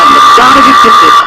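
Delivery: loud hissing strike; snake lunging. A narrator's reading. Narrator voicing the snake's loud strike. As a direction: snap the line out like a striking snake.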